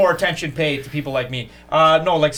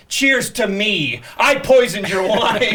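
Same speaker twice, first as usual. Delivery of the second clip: slow, louder, high gloat; gloating villain; 6 semitones higher, 4 dB louder, much slower than usual. Retold or imitated. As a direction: gloat slowly over the poison. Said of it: "He's like".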